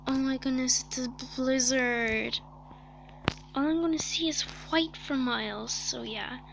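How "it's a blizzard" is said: ordinary talk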